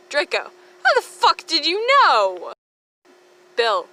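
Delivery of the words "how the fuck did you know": high, loud, cracking disbelief; shocked outburst. Acted. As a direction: caught out and mortified.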